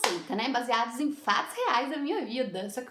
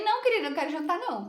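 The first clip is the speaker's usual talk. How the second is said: higher, emphatic polite brush-off; retold refusal; 6 semitones higher, faster than usual. Narrator refusing dinner to avoid cooking, mock polite. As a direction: turn her down with mock politeness.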